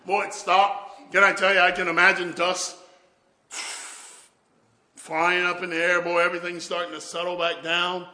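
loud, high preacher's fervour; fired-up revival preaching; preaching at full force. Acted. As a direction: build the sermon to a roaring climax.

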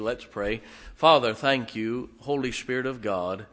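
ordinary talk, nothing marked

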